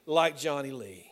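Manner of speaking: loud, rising preacher's cry; hammering the point home, voice lifted high; preaching at full force. Acted. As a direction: preach it out to the back row. Preacher builds to climax.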